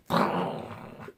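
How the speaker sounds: spluttered burst of air; wordless sputter, like a dam giving way; imitated gush of tears. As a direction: blow out a noise for tears bursting forth. Imitates tears suddenly pouring out.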